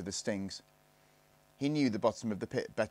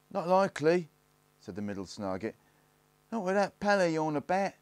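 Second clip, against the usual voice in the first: put-on cocky boy; 9 semitones higher, 4 dB louder than usual. A narrator's reading. Middle Snargit answering.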